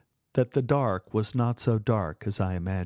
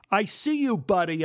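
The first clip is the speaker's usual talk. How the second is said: high call across the yard; yelling; 7 semitones higher than usual. Acted. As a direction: call him out, caught you. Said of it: "I yelled".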